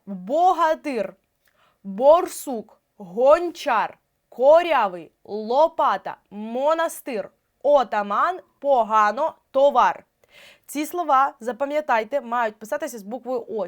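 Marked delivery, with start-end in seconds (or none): 0.1-10.0 word list, slow and loud, every О fully sounded; читання з чітким О. Shown as a demonstration. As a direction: dictate БОРСУК, ГОНЧАР by syllables with full О. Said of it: "І так читаємо". (БОГАТИР, ТОВАР голосно, з виразним О)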